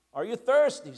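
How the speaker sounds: put-on lion calling to the thirsty girl. A narrator's reading. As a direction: call out across the pool. Lion calls to Jill.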